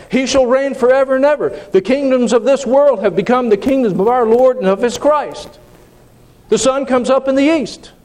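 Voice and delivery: high, ringing declarations; climactic preaching. Acted. Preacher declaring Jesus's kingship to the congregation, high and ringing. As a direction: declare each line as settled fact.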